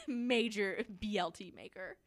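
laughing, reading a grand fake title; laughing mock title. Cohost inventing a joke business card, laughing. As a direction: crack up announcing the killer's card.

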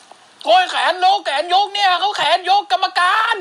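high, slowed-down protesting yell; Pat McAfee yelling. Retold or imitated. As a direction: shout at the referee that the arm was up. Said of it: "แพทแมคกาฟี่โวยวาย".

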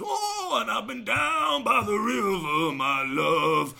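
hammed-up soulful crooning, stretched out; mock soulful singer. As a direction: ham it up like a brooding guitar singer. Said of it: "he puts a lot of soul and emphasis".

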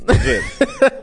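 laughing. Real, not acted. Laughs at own joke.

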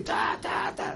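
soft mimicked horn toots; short vocal honks, held down in volume; imitated car honking. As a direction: toot like an impatient car horn. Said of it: "בכביש עכשיו מצפצפי".

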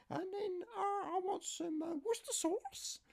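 niece's voice. Retold or imitated. Niece phoning her uncle for help, high falsetto. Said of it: "I've got wrong voice".

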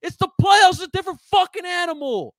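high, loud yell; angry shout. Real, not acted. Scolding fans about playoff fear, shouting high and loud. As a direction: bellow it at them.

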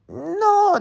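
very high tiny crab voice; high squeaky crab no. Acted. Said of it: "dijo el cangrejito".

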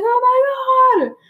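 high, slow drawn-out mock wail; childish wail. Retelling the childhood kiss, a high slow mock wail. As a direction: stretch it out like an upset little kid. Child running inside crying.

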